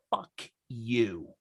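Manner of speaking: soft, low, measured insult; deliberate retort. Retold or imitated. Son talks back to the woman at the bar, quietly defiant. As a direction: drop it quietly, one word at a time.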